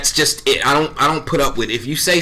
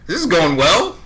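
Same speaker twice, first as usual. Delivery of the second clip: higher, slower, relieved; pleased inner voice; 6 semitones higher than usual. Retold or imitated. Hearing praise from the executives, slow and pleased. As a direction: savor the good news. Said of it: "I'm like".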